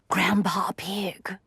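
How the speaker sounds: slow whispered greeting; whispering. Acted. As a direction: whisper the name slowly. Greeting in a quiet clubhouse.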